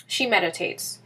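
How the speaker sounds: ordinary delivery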